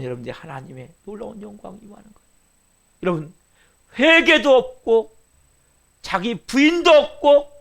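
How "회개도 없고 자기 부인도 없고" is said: 1 full-force preaching. Real, not acted. Preaching climax.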